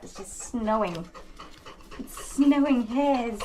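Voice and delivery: normal talking voice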